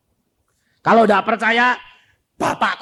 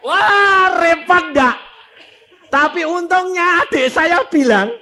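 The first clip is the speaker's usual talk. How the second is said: high, loud burst of amazement; excited shout; 12 semitones higher, 8 dB louder than usual. Acted. Preacher telling the crowd the shocking news, shouting. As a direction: blurt it out, stunned and loud.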